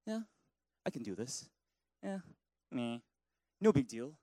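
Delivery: low, soft casual shrug; mock nonchalance. Preacher voicing a calm believer facing problems, casual shrug. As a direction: wave off trouble like it's nothing.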